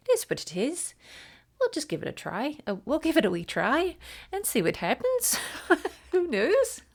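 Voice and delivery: hammed-up attempt at Nanny's accent, laughing at herself. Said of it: "for butchering your accent".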